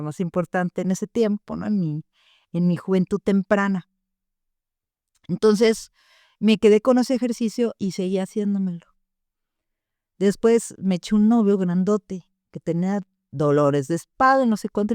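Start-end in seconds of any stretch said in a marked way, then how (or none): none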